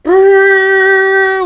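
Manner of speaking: long, loud high trill; alarm clock ring. A narrator's reading. Alarm clock waking Mister Bear, loud trilling. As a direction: hold a high buzzing ring. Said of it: "went the alarm clock".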